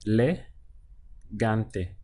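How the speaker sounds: le- gante broken into syllables. Shown as a demonstration. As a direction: spell out le- gante slowly. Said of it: "So we have e".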